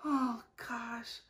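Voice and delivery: softly fantasizing about the block party; dreamy hush. Real, not acted. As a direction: drift into a private daydream. Daydreaming about finding a husband.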